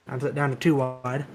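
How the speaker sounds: usual speaking voice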